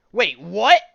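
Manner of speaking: loud, high disbelieving yelp; stunned loud what; shocked outburst. Real, not acted. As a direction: blurt it out in sudden shock. Shocked by the comic's twist.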